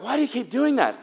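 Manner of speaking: mock-wailing at the repeat offender; a light, high put-upon whine; exasperated whine. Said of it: "And you're like".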